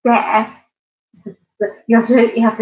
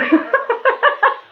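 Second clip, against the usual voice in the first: a squealing giggle, pitched way up; high laugh. Real, not acted. Laughing to the chat at a viewer's funny name. Laughing at Happy Cow Moo.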